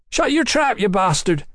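high-pitched angry outburst; rage and pain. A narrator's reading. Young brother silencing the taunting prisoner, enraged. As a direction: burst out high with pained rage. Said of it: "his voice high with rage and pain".

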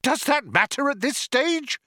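high-pitched exasperated cry; shouting. A narrator's reading. Arthur arguing as death nears, high exasperated shout. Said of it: "shouted Arthur".

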